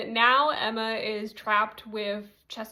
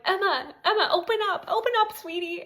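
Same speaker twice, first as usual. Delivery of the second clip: frantic calling; 9 semitones higher, faster than usual. Retold or imitated. Mom banging on hotel door calling Emma, frantic. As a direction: call through the door in a panic.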